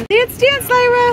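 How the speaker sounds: high, loud cheer. Real, not acted. Cheering baby to dance.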